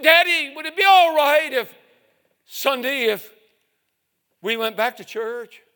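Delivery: put-on high, loud little boy voice; eager child's plea. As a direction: ask Daddy hopefully. Boy asks father again.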